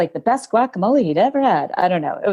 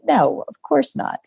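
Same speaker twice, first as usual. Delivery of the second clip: ordinary delivery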